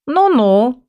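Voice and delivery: a sniffy cat brushing it off; scoffing cat. A narrator's reading. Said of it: "фыркнул кот".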